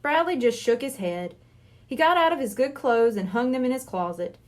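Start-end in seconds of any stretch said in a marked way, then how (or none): none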